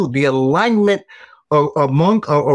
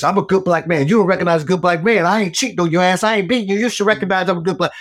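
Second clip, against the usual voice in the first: higher-pitched, hurried protest; indignant self-defence; 7 semitones higher, faster than usual. Retold or imitated. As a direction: rattle off a mock-indignant defence of yourself. Acted indignant self-defence to his wife.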